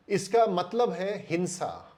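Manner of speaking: ordinary talk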